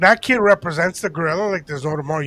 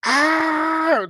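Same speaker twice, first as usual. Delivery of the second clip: high mock shriek, louder; retold scream; 12 semitones higher, 5 dB louder than usual. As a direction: imitate her scream, pitched way up. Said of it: "all I hear is".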